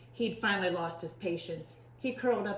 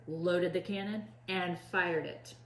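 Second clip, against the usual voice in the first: ordinary delivery, nothing marked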